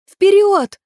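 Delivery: high, loud battle cry; exclamation. A narrator's reading. Maeve urging the river horse forward, high loud cry. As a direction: shout the charge at full pitch. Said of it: "воскликнула она".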